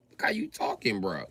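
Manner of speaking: normal talking voice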